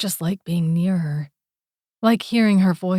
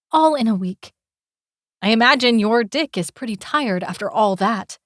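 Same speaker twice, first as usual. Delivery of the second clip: higher, louder taunting female voice; mocking young woman; 4 semitones higher, 5 dB louder than usual. Girl mocking Calum about his rumored conquests, higher and scornful. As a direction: needle him with sarcastic rumors.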